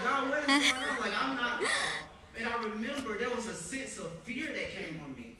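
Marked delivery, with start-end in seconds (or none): 0.0-1.7 high, loud alarmed plea with a laugh; hammed-up panic to God; panicked cry. Retells his panicked prayer.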